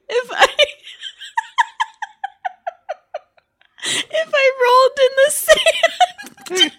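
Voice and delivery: high, loud, breaking with laughter; can't get the words out for laughing; laugh-crying. Real, not acted. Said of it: "I can't stop crying now".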